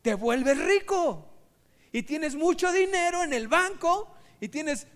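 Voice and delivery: loud, grand announcement; excited promise. Acted. Student selling riches to the fisherman, loud and grand. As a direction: announce the wonderful part. Student describes getting rich.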